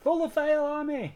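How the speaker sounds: big high announcer shout. Acted. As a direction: belt out the channel name like a host. Channel name shouted out.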